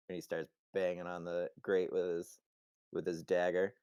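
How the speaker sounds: ordinary delivery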